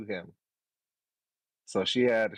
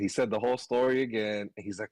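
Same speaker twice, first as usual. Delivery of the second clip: normal talking voice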